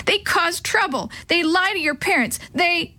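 high, upset complaint, cut off; tearful outburst. A narrator's reading. Maria complaining about brothers to everyone, tearful. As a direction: pour it out, pitched well up. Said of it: "she sobbed".

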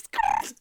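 low, loud skidding tire noise; tire screech. Retold or imitated. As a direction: make a car skid to a stop. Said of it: "you're welcome for the sound effects".

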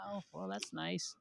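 small, deflated mock congratulation; feigned politeness. Envious reply to others' good gifts.

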